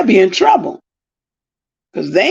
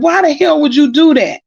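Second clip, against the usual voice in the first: higher-pitched, exasperated retold question; retold cussing out; 6 semitones higher than usual. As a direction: act out demanding an explanation. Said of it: "I had to cuss them out".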